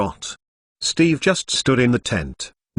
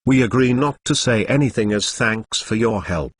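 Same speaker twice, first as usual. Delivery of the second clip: ordinary delivery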